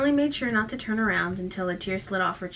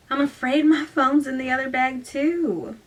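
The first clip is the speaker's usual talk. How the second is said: higher, louder Southern belle; apologetic Southern accent; 5 semitones higher, 5 dB louder than usual. A narrator's reading. Bluffing girl's Southern excuse.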